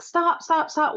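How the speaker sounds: high-pitched panicked pleading, imitated; mock screaming. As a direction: act out a squealed plea to stop. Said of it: "he's screaming and flopping around".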